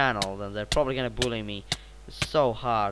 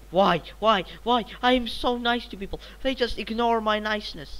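aggrieved whining; 8 semitones higher, 3 dB louder than usual. Real, not acted. Complains that niceness is ignored.

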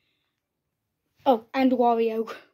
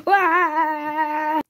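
long wavering wail; play-acted scream; mock scream; 2 semitones higher than usual. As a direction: cry out as you get cornered. Cornered character screams.

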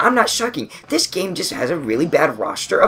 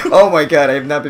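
ordinary talk, nothing marked

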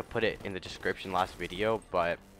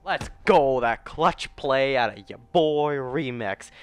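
higher, louder, slow triumphant boast; victory hype; 5 semitones higher, 8 dB louder, slower than usual. Real, not acted. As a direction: crow over the win, dragging it out. Winning with clutch play.

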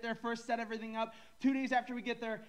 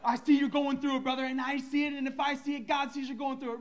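mock pious advice; 5 semitones higher, 5 dB louder than usual. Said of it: "Sometimes Christians give the worst advice".

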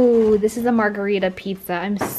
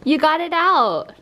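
higher, louder happy praise; excited praise; 6 semitones higher, 4 dB louder than usual. Real, not acted. Owner praises Bronx for getting the treat, excited. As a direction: cheer the dog on. Dog gets treat from toy.

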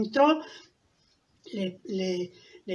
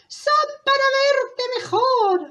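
high, slow fake-granny voice; wolf as grandmother; 6 dB louder, slower than usual. Wolf in disguise answering the girl, high drawn-out voice. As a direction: draw it out high, playing granny. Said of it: "como si fuera la abuelita".

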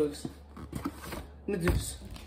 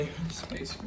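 whisper; 9 dB quieter than usual. Real, not acted. Whispered aside while setting up.

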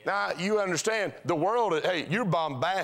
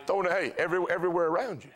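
usual speaking voice

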